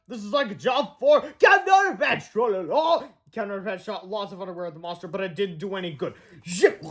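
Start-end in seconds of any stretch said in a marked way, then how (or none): 0.1-3.0 heroic shout. Acted. Heroic call.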